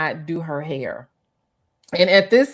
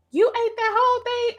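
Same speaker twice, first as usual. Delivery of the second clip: shocked coworker. Retold or imitated. Gwen voiced in disbelief.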